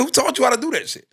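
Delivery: very high-pitched, exclaiming disbelief; incredulous self-questioning. Acted. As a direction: call yourself out in high-voiced amazement. Repeats acted incredulous self-question.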